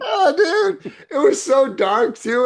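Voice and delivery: high, squealing laugh through the words; laughing so hard the voice goes up. Real, not acted. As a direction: crack up while telling it. Laughing retelling scary ride.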